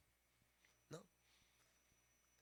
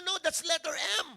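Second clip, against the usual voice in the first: firm, insistent correction, pitched higher and louder; insisting; 8 semitones higher, 11 dB louder than usual. Retold or imitated. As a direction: person insisting they are right, stubbornly correcting. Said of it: "pinag-ini-insist mo".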